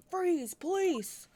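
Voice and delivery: loud, slow barked order; shouted command. Acted. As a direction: yell it like a cop storming in. Cop bursts in shouting.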